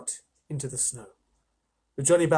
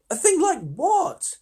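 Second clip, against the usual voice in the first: alarmed question; 8 dB louder, much slower than usual. A narrator's reading.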